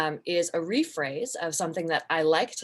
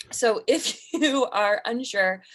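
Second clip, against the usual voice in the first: laughing; 4 semitones higher, 5 dB louder, slower than usual. Real, not acted. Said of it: "you turtle so hard when I use voices".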